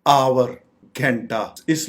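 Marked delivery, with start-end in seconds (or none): 0.1-0.6 "आवर" said AA-wur in two syllables; correct pronunciation. Shown as a demonstration. Teacher modelling the correct word, "आवर" as AA-wur. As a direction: give "आवर" a clear w: AA-wur. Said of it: "इसकी correct pronunciation है".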